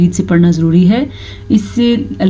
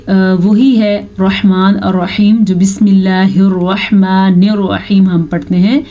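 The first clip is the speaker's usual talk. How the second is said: ordinary talk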